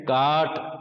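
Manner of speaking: कार्ट said as काट, a long aa with no r; non-rhotic काट with the r gone; silent r, long a. Shown as a demonstration. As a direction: say काट with a drawn-out aa. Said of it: "आर बिल्कुल गायब हो गया".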